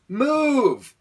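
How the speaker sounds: loud, high, drawn-out yell of MOVE; re-enacted exasperated shout; retold yell. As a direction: yell it at a stubborn bird. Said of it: "I yelled while flailing my arms".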